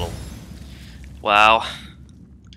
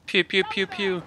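mouthed gun sounds; 7 semitones higher, 7 dB quieter, slower than usual. Pretend. Mimicking gunfire sounds.

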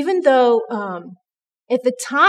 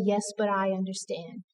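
softer, lower, tender reply; a hushed, loving answer; gentle reassurance; 3 semitones lower, 12 dB quieter than usual. Retold or imitated. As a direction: soothe her quietly as God would. God answers her tenderly.

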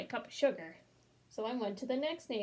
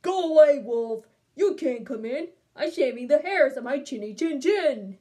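angry yell; 2 semitones higher, 11 dB louder than usual. Acted. Pig to wolf at the door, yelling. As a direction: yell it through a closed door. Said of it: "He yelled".

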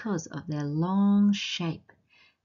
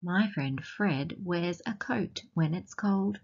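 ordinary delivery

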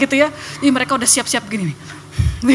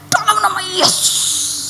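stern rebuke; 8 semitones higher, slower than usual. Retold or imitated. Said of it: "saya kasih muka paling jelek".

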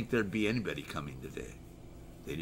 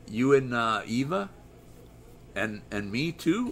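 ordinary talk, nothing marked

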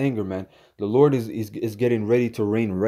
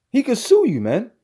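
repeats "He could sue you" much higher and louder; mocking outrage; 12 semitones higher, 3 dB louder than usual. Real, not acted. Ranting to viewers, squeals in mocking disbelief.

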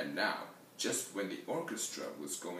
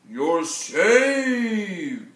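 huge drawn-out high bellow; roar; 9 semitones higher, 17 dB louder than usual. Acted. Ox lumbering up to the stuck animals, triumphant roar. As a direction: roar it out, stretching the last word. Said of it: "roared the ox".

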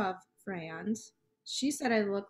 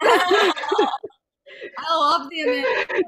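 laughing; 8 semitones higher, 11 dB louder, slower than usual. Real, not acted. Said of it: "laughing delight at impression".

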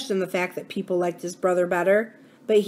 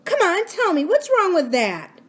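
insistent; 11 semitones higher, 4 dB louder than usual. A narrator's reading. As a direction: press him, drawing it out. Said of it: "He didn't answer her".

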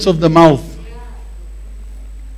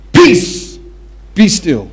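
commanding Jesus; much slower than usual. Acted. Preacher voicing Jesus rebuking the storm, commanding. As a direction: speak peace to the storm like Jesus.

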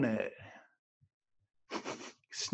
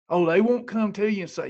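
normal talking voice

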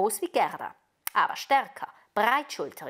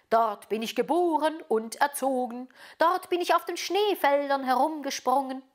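slightly raised, proud reindeer voice; 6 semitones higher, 4 dB louder than usual. A narrator's reading. Reindeer remembering the snowfields of its birth, proud. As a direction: recall the homeland with relish.

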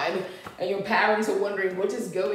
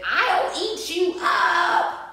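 put-on high, drawn-out boy's threat; defiant threat; 11 semitones higher, 5 dB louder, much slower than usual. Max answers back in the story, defiant threat.

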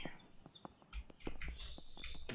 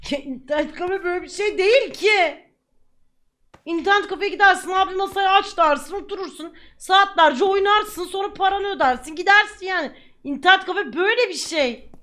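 higher and louder, heated explaining; worked-up rant; 5 semitones higher, 6 dB louder than usual. Real, not acted. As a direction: vent at the friend, voice raised. Heated rant about internet café.

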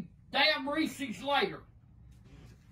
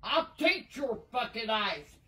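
normal talking voice

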